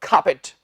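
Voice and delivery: खापेट as aspirated KHAA-pit, silent R; correct pronunciation. Shown as a demonstration. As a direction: puff the K in खापेट, short pit ending. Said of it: "क्या कहना है हमने?".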